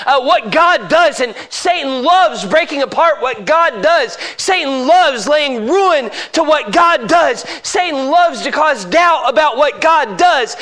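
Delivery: high, loud, hammering sermon delivery; full-force preaching. Real, not acted. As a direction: drive each phrase home at full voice.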